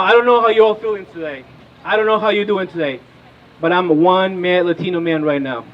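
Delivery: usual speaking voice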